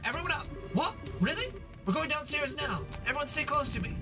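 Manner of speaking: high, urgent commands; urgent matron. Acted. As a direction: herd the kids out fast. Matron leading evacuation.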